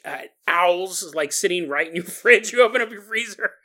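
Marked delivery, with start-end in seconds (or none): none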